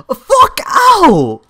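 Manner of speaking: loud, high, drawn-out wail of rage; furious yell. Real, not acted. Player losing to a spammy opponent, furious yell. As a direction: wail it out in real frustration.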